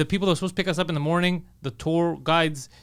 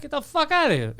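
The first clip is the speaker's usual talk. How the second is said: exasperated; 12 semitones higher than usual. Retold or imitated. Ranting at slow tourists.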